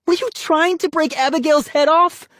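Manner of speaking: high, loud indignant cry; upset accusation. A narrator's reading. Sister accusing brother of wrecking doll, upset.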